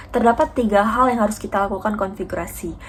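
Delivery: usual speaking voice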